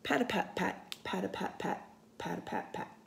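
ordinary delivery, nothing marked

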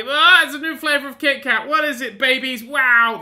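high, loud excited outburst; mock amazement. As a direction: gush in exaggerated wonder, high and loud. Acts out his silly dream.

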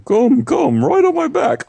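very high squeaky fish voice. Retold or imitated. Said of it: "The golden fish calls out to you".

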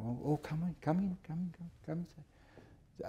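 soft, unvoiced-fading welcome murmured at the door; quiet, hurried beckoning that fades to a whisper; hushed greeter. Séance greeter letting the lady in, hushed.

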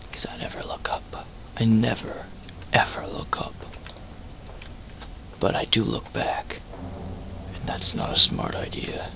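hushed unvoiced whisper. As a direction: whisper it close to the mic. Whispered creepy asides.

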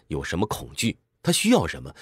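ordinary talk, nothing marked

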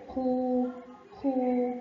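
خو with a held, even uu; long uu vowel. Shown as a demonstration. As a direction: stretch the vowel in خو. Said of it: "خاء ضمة ومد بالواو".

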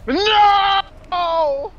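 shout. Real, not acted. Caught by monster.

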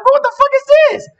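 high, fast, disbelieving yelp; reacting to a song he had never heard; stunned question. High fast shocked reaction. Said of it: "I'm like".

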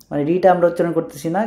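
ordinary talk

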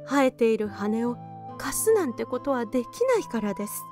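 usual speaking voice

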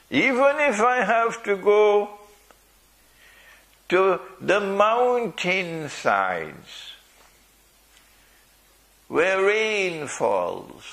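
high, drawn-out preaching cry; ringing resolve from the pulpit; impassioned vow. Acted. Preacher vows to keep his faith.

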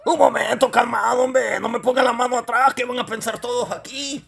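high, flustered comic puppet voice; indignant high-pitched little puppet; alarmed puppet character. Acted. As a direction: protest urgently in a high character voice. Puppet Deca objects to being held.

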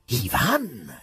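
ordinary delivery, nothing marked

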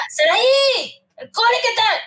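mock stern mum, her name a warning call; angry mother. Mother who is angry greeting her daughter from school, mock sharp call. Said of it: "sabía si mi madre estaba enfadada o no".

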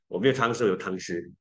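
normal talking voice

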